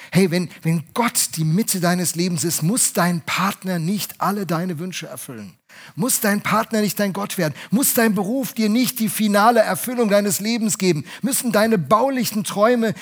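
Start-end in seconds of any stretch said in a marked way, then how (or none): none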